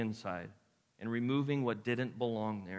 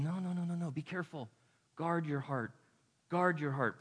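gentle warning; 3 dB quieter than usual. Retold or imitated.

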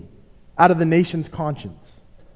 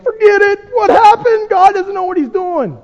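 exasperated outburst; 13 semitones higher, 7 dB louder than usual. Retold or imitated. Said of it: "we're just like".

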